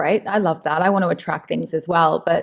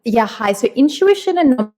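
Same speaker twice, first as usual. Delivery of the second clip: higher-pitched, animated mock argument; acted pleading reply; 6 semitones higher, 3 dB louder than usual. Intuitive arguing with a business strategist, animated and higher-pitched. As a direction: plead your case in a playful acted retort.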